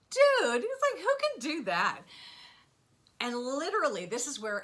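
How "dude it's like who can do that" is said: much higher, louder exclamation of disbelief; incredulous outburst. Retold or imitated. Reacting to the calm audiobook advice with loud disbelief. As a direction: blurt it like you can't believe the advice.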